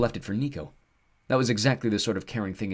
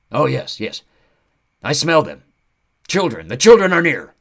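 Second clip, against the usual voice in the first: high loud eager creature; thrilled, catching the scent; excited centaur; 6 semitones higher, 12 dB louder than usual. Acted.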